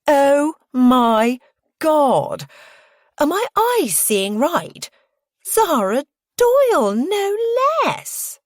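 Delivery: loud, high, drawn-out squealing; over-the-top shrill young woman's delight; excited squeal. A narrator's reading. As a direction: squeal slowly in gushing disbelief. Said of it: "the young woman squeals".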